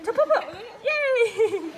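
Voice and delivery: loud, high burst of delight; excited cheer. Real, not acted. Visitor cheering otters popping out of water, excited cheer. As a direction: cheer as they pop up.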